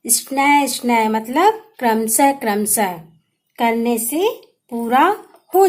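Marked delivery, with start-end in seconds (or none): none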